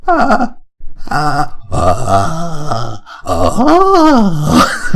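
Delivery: high wavering "ah" sounds; fragile "ah" attempts. Shown as a demonstration. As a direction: try short held notes, jumping high. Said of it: "we've lost the fragility".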